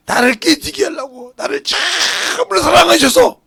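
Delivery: slow, high, loud cry to God; impassioned acted outpouring; tearful cry of realization. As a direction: act out a broken believer crying out. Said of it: "그때서 울고".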